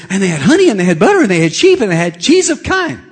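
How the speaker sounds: put-on high, loud child's gush; excited six-year-old. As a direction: rattle it off like a thrilled little kid. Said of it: "he talks a hundred miles an hour when he gets excited".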